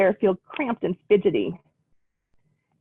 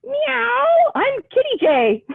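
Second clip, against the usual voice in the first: very high meowing kitty; cat voice; much slower than usual. Acted. Kitty Jay introducing herself at the party, very high. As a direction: mew like a little cat.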